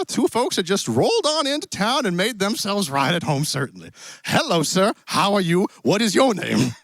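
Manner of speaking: put-on cowboy accent. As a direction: play a genial Western cowboy host. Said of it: "apparently some cowboy accent".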